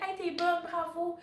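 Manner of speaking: high, bright cheer of praise; enthusiastic praise. Retold or imitated. Teacher cheering a student on with praise. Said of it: "pis dire".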